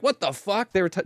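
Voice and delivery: ordinary talk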